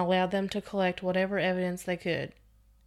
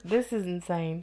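ordinary delivery, nothing marked

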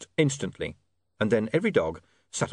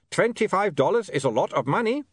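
put-on high, raised voice with an accent; calling over noise; 10 semitones higher than usual. Otto calls to William over the dogs, raised voice. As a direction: project it over a racket.

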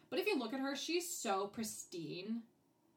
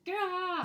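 loud, higher exasperated "gah"; frustrated yell; 10 semitones higher, 7 dB louder than usual. Retold or imitated. Viewer fed up with garbled cartoon dialogue, frustrated yell. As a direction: burst out in exasperation. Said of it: "frustrating as fuck".

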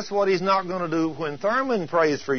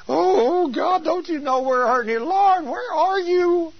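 mock begging; 4 dB louder than usual. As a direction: plead in an exaggerated high-pitched cry, stretching the words. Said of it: "They're begging and pleading".

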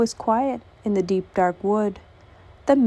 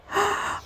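frightened gasp; 12 semitones higher, 5 dB quieter than usual. Acted. Mouse sees the Gruffalo.

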